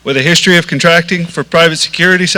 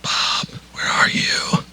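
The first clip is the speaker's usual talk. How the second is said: whisper; 12 dB quieter, much slower than usual. Pretend. Calling for the missing Bob.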